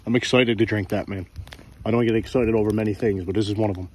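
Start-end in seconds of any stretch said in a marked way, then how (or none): none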